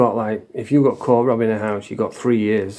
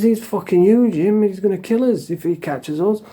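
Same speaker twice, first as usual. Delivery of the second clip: retold panic, pitched higher; nervy, higher voice, reliving the fear; scared whisper-shout; 9 semitones higher than usual. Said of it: "I'm scared as shit".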